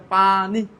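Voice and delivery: "পানি" broken in two, pa, ni; two drawn-out beats on "পানি"; split into syllables. Shown as a demonstration. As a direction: slowly stretch "পানি" syllable by syllable. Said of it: "কয়টা সিলাবল আছে এখানে".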